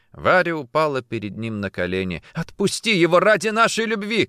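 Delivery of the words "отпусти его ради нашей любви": high, loud, desperate plea; begging. A narrator's reading. Woman on knees begs for man's release, imploring. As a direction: implore for mercy. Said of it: "я буду умолять".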